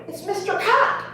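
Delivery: put-on Boston accent dropping the R in Cop; imitating her son saying Cop the Boston way, no R; child's Boston accent. Son correcting mom about the fish book, in a Boston accent. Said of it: "okay, Boston accent".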